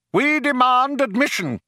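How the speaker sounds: high-pitched protesting shout; indignant shout. A narrator's reading. Angry younger man bursts into the room, indignant shout. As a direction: shout your way through the door. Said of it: "shouted the younger of the two men".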